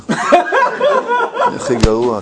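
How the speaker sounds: very high, slow, drawn-out mocking whine. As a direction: stretch it out, mocking her. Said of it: "husband mocks wife". (בעל מחקה את אשתו בקול גבוה ולועג)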